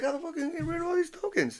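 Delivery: fast, high-pitched bewildered whine; mock exasperation. Dad stuck with tokens, fast high mock panic.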